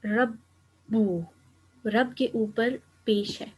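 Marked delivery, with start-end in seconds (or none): 0.1-1.2 رَبُّ stretched very slowly with doubled b and final u held; drawn-out emphasis. Shown as a demonstration. Teacher slowing down on the word to show its ending, stretched and deliberate. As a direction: lean on the pesh ending of رَبُّ. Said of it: "اعراب کی مدد سے دیکھیں".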